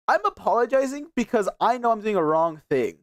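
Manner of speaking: ordinary talk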